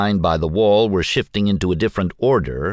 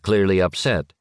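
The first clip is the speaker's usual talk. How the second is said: ordinary delivery, nothing marked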